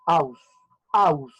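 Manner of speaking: "aus" as one loud beat, A dominant; diphthong in one beat. Shown as a demonstration. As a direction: punch "aus" alone, leaning on the A. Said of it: "la A suena más". ("aus" en un solo golpe, con la A más fuerte)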